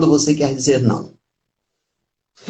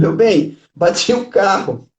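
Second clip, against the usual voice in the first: cheerful confession; 6 semitones higher than usual. Retold or imitated.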